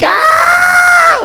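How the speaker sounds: very high, drawn-out mock wail; grief wail. Owner hears his cat won't make it, high mock wail. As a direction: wail in sudden hammed-up grief. Owner wails at cat's death news.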